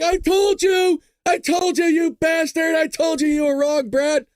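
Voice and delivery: high, loud mock outburst; hammed-up I-told-you-so yelling; gloating viewers. Imitating viewers gloating at the host, high mock shout. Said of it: "Some of you are like".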